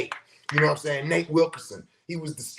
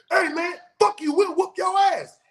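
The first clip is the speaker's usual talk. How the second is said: higher, louder retold taunt of a teen; mock threatening yell; 13 semitones higher, 3 dB louder than usual. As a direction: shout it back like the big brothers. Teen boys threaten him.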